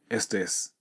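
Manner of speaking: ordinary delivery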